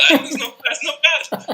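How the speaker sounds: laughing through the words, pitched high; amused laughing. Real, not acted. Friend reacting to the British accent attempt, laughing.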